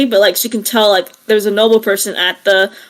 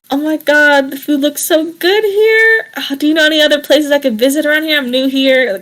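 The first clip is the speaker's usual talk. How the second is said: high, chirpy newcomer gush; excited gushing; 5 semitones higher than usual. Acted. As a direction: gush at the vendor, pitched up. [name] gushing at food stand.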